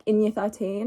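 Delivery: ordinary talk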